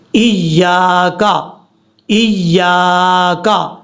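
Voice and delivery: very slow 'ایاکا', long yaa; long alif. Shown as a demonstration. Teacher shows the lengthening, slow correct recitation. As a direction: stretch the middle of 'ایاکا'. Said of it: "لمبا کر کے پڑھنا ہے".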